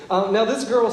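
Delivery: normal talking voice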